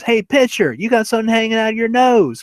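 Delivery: high-pitched playground jeer; taunting. Retold or imitated. Henry jeering at the pitcher's mustache, taunting. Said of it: "he's just still taunting him".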